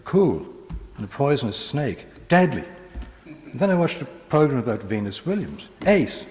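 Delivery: put-on high, bright gushing girl on phone; chatty teenage girl. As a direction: rattle it off like a teen on her mobile. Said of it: "here we go, Girl on Mobile".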